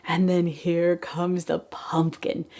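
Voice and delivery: ordinary talk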